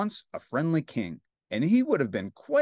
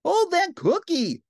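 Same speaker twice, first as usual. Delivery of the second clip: high, loud, slow shout; princess crying out; 7 dB louder than usual. Acted. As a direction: stop him with an urgent cry. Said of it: "cried the princess".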